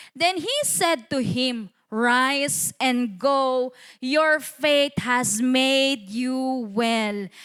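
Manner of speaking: slow, spaced-out Bible reading; scripture reading. Acted. Preacher reading verse fourteen to the church, slow declamation. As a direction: read the verse out slowly, word by word.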